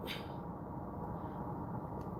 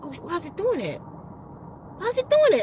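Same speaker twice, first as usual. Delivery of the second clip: startled wonder; 4 semitones higher than usual. Retold or imitated. Energy suddenly building, talking to herself.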